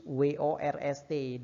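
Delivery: W O R S T spelled out letter by letter; letters of worst said one by one; spelling out letters. Shown as a demonstration. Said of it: "Terburuk itu worst".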